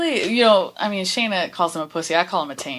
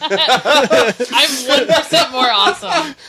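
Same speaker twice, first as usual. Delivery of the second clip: high, slow, loud and laughing; laughing mock slogan; 7 semitones higher, 7 dB louder, much slower than usual. To friends, as a cheesy beer slogan, laughing. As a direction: stretch it out like a cheesy ad tagline through laughter.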